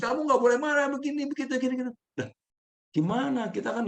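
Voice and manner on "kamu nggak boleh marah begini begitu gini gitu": higher, imitated scolding; higher mock nagging of an angry person. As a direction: nag them not to be angry, in play. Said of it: "Bukan malah ngomelin".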